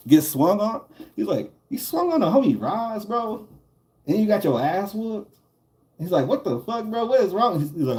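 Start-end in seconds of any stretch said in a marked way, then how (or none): 1.7-5.3 high, incredulous scold; mock scolding. The big homie teasing the kid for swinging on Roz, high and incredulous. 6.3-7.6 high, exasperated question; incredulous scold. Retold or imitated. The big homie asking the kid what is wrong with him, high and exasperated.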